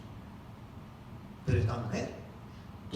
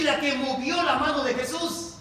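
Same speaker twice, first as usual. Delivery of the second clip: loud, raised preacher's declaration; full-force preaching; 9 semitones higher, 6 dB louder than usual. Real, not acted. Preacher urging believers to trust in God's crumbs, full-force preaching. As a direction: drive each phrase home to the congregation.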